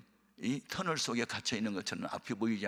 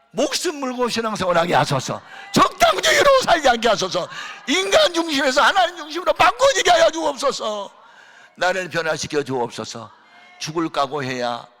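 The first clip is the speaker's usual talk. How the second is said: much higher and louder pleading prayer; fervent shouted prayer; 11 semitones higher, 14 dB louder than usual. Acted. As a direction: cry out to God with full force.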